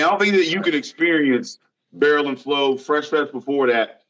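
normal talking voice

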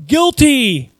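high, loud cry of condemnation; crowd's cry. A narrator's reading. Council condemning Jesus, cried out loud. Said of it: "they all cried".

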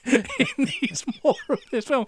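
high-pitched, laughing insistence. Real, not acted. Reviewer raving about a daft exorcist film, laughing.

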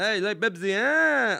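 high, loud mock-patronizing merchant; talking to little boy. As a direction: tease him like a small child, rising to a shout. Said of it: "like a little boy".

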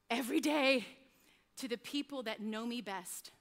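normal talking voice

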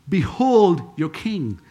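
slow, emphatic "Behold your king"; stately announcement, stretched out; slow proclamation. Acted. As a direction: draw out a strong "Behold", then "your king". Pilate presenting Jesus, first take.

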